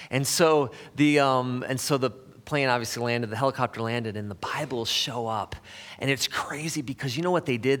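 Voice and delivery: ordinary talk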